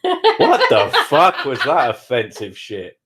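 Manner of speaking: slow, laughing mock outrage. Real, not acted. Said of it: "That was just racist".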